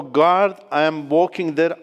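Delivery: normal talking voice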